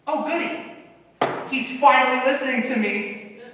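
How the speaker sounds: high, delighted doctor voice; a chirpy, smug doctor cheering the news; gleeful doctor. Acted. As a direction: play the doctor pleased as punch. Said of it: "And the doctor says".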